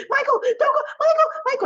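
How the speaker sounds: high pleading calls. Retold or imitated. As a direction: chase him calling his name up high. Olivia chasing Michael calling.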